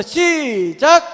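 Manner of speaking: long drawn-out, loud count-in; calling the cue. Real, not acted. Preacher cues the congregation to read aloud together, drawn-out call. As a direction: call it out high and stretched to start everyone together.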